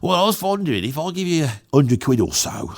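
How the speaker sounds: lightly voiced Cockney bloke, quick; a hint of a Cockney geezer asking for a favour. Cockney bloke commissioning a galleon painting, mild Cockney. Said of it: "I'll do that accent".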